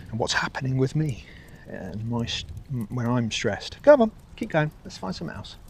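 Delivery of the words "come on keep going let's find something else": raised coaxing call; urging the dog. Real, not acted. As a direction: chivvy the dog along.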